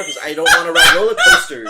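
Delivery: loud mock-panicked protest; retold panic. Retelling a first coaster ride with dad, panicked protest. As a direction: complain loudly as a rattled first-time rider.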